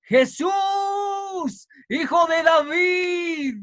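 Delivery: high, drawn-out crying out; dando voces. Acted. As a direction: cry out like a blind beggar pleading for mercy. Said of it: "comenzó a dar voces y a decir". (clamando a voces)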